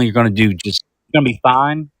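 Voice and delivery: normal talking voice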